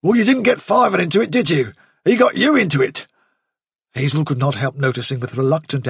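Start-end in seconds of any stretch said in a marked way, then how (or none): 0.0-3.1 higher, pointed comeback; sharp soldier rabbit; Bigwig's retort. A narrator's reading. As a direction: turn the blame back on the leader.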